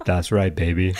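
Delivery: low, mock-sexy put-on voice; Big Al. Husband teasing his laughing co-host, Big Al voice. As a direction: purr it deep as a self-styled seducer. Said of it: "Big Al".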